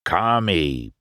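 ordinary delivery

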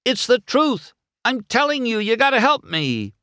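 very high-pitched strained shout; desperate yell. A narrator's reading. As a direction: yell after someone driving away. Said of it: "He yelled after the minivans".

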